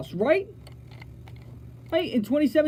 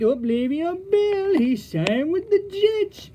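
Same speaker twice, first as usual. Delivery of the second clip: mock excited fan; 7 semitones higher, slower than usual. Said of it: "a lot of other people are like".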